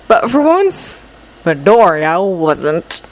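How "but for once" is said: plain reading voice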